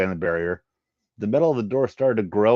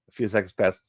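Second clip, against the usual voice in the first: ordinary delivery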